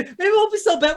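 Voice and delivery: ordinary delivery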